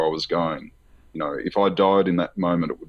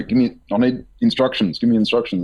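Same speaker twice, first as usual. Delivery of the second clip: higher, louder insistent request; urgent demand; 5 semitones higher, 5 dB louder than usual. Retold or imitated. Asking the beings what to do, urgently. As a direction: press them for instructions, retold panic.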